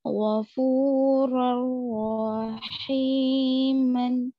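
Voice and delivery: गफूर रहीमन drawn very slowly, r joined; slow Tajweed reading; slow recitation. Shown as a demonstration. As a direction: recite गफूर रहीमन without nasal hum. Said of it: "नून की आवाज को".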